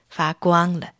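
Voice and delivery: usual speaking voice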